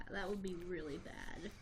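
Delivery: ordinary delivery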